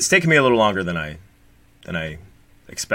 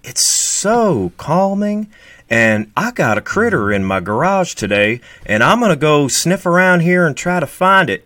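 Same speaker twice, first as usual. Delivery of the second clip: Steve impression; 8 semitones higher, 6 dB louder than usual. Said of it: "Steve is like".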